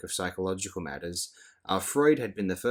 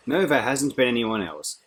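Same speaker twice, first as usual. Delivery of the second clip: louder, defiant retort; bristling, almost rude answer; truculent; 4 semitones higher, 6 dB louder than usual. A narrator's reading. Lenina answering Fanny's accusation, truculent and defiant. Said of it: "she answered almost truculently".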